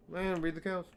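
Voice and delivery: high, loud enthusiastic yelp; sudden excited outburst. Real, not acted. Streamer agreeing with chat, excited yelp.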